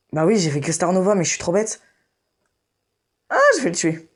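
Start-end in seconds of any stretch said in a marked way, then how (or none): 3.3-4.1 raging gamer. Real, not acted. Frustrated gamer yelling in game.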